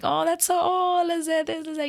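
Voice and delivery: high excited gushing; gushing reader. Retold or imitated. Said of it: "I'm like".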